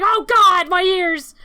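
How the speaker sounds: loud yelp, covering ears; pained yell. Acted. Billy shouting at the fire alarm, loud pained yell. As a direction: cry out at the noise. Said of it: "he's like".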